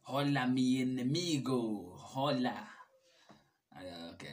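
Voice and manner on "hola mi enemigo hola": put-on louder, slow, theatrical Spanish accent; hammed-up Spanish accent. Reading the translated greeting aloud, slow, louder mock Spanish accent. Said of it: "I don't know why I'm doing an accent".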